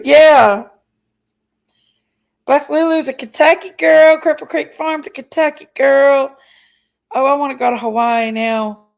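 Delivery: ordinary talk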